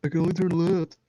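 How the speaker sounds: playful impression of a vain model; Zoolander quote. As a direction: quote the model's line in a silly voice. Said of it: "What, like Zoolander?".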